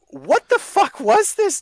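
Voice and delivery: very high, loud, slow squeal of disbelief; sky-high, stretched-out indignation; incredulous outrage. Real, not acted. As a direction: wail the question in exasperation. Repeats the outraged question.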